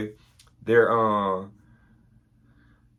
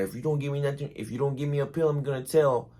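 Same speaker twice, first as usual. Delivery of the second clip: higher lisping Mike Tyson threat; 6 semitones higher, faster than usual. Retold or imitated. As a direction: threaten to tell, with a lisp. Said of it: "kinda talked like, uh, Mike Tyson".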